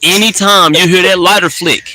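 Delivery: high and loud hype; excited. Real, not acted. Telling friend about [name], loud and excited. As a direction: pump up the lighter flick.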